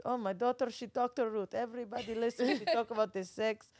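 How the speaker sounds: proud Israeli dad imitation; Israeli-accented bragging about a daughter. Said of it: "That's a great Israeli accent".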